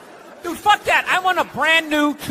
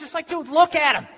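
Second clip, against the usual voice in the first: ordinary talk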